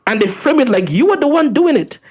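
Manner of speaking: ordinary delivery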